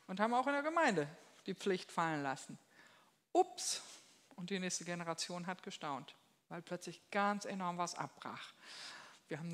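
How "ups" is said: hoch und laut: Ups!; überraschtes Ups. Real, not acted. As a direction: ein gespielt erschrockenes Ups. Ironischer Kommentar zum Abbruch. (viel höher und lauter, ironisch erschrocken)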